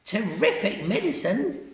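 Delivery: put-on high cackling old woman; old Grandma voice. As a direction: relish the medicine greedily. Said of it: "she said".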